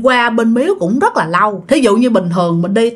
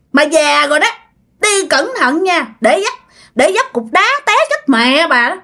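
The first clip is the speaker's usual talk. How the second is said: put-on possessed girl's voice; 7 semitones higher than usual. Possessed girl mocking old bà O, high sneering voice. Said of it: "Nói vậy các bạn".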